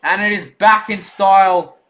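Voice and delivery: rally the fans with pride; excited hype. Acted. Celebrating team lead.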